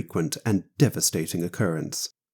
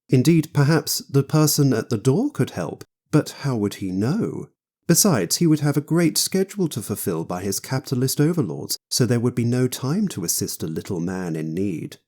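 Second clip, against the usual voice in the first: ordinary delivery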